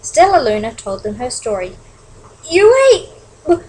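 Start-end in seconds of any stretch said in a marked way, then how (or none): 2.4-3.6 high, loud broken disbelief; aghast stammer with pauses; shocked stutter. Acted. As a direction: stumble on the word, then blurt it. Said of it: "stuttered one".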